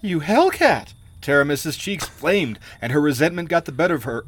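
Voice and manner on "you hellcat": high, louder indignant outburst; outraged. A narrator's reading. Queen insulting her treacherous sister, outraged.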